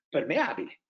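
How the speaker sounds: ordinary delivery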